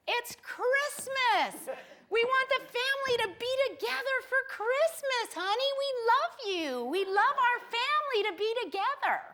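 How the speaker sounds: high, gushing mock-loving plea; coaxing, seductive parents. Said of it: "I was pulled in by the seduction".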